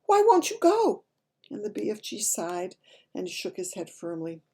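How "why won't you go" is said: much higher and louder, slow pleading; pleading cry. A narrator's reading. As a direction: beg him to go, crying out. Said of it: "Sophie cried".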